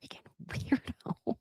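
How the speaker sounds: breathy, slow muttered aside; whispered aside. Real, not acted. Host whispering a mock insult about Alexis. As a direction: hiss it under your breath.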